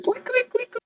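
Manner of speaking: very high, quick squeaky noises; imitated shoe squeaks. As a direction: mimic sneakers squeaking on a court. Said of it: "the shoe squeaking when you hear".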